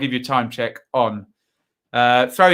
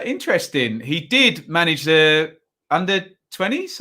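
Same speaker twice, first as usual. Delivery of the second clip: normal talking voice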